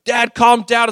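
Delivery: put-on kids telling dad off. Kids push back at stressed dad.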